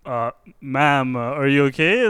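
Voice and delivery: low, hesitant check-in; awkwardly ask, halting and unsure. Acted. Bystander checking on a woman hitting herself, acted awkward. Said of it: "You just stand there awkwardly".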